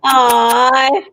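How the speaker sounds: high, drawn-out doting coo; affectionate cooing. Real, not acted. Host answering love sent to Chico, high affectionate cooing.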